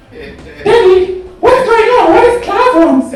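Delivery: very high, loud falsetto wail; hammed-up panicky squeak; mock panic. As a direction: squeal it in comic alarm. Acts alarmed self being dragged upstairs.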